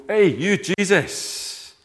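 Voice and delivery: slow, loud, high call of outrage; indignant shout. Retold or imitated. A mourner shouting at Jesus, loud and slow. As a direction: yell across the crowd at him. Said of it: "to go".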